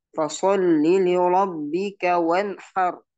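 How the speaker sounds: loud slow recitation, clean N in "wanhar"; measured chant with short N in "wanhar"; correct clear nun. Shown as a demonstration. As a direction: recite out strongly, no hum in "wanhar". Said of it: "Tidak boleh membacanya wanhar".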